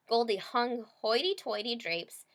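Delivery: plain reading voice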